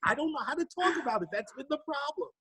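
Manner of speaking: high, exasperated protest; comic helplessness. Retold or imitated. Widowed father unsure how to talk, comically helpless. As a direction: plead total cluelessness in mock distress. Retells his helplessness, listener laughing.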